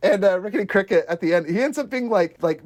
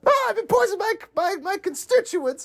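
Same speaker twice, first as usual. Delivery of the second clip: mock dying politician. Retelling the poisoned politician, a higher hammed-up wail. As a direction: ham up a dramatic poisoning.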